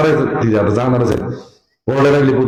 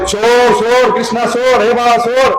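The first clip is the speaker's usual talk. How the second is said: screaming let go; 7 semitones higher, slower than usual. Acted. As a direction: shriek at the child to release her. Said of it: "काय म्हणाली?".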